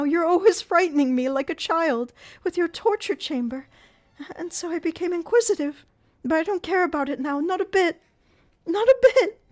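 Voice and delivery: anguished. A narrator's reading. Christine to Eric, anguished high terrified voice. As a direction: beg in anguish. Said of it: "Christine's voice of anguish".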